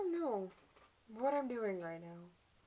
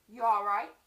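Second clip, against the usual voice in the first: louder quoted question; husband's concerned question; 16 dB louder, much faster than usual. Acted. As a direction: play the husband checking on her. Acts husband asking after blow.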